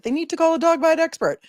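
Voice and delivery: high-pitched mock viewer voice; imitating commenters. Voices viewers' reaction after "they're like.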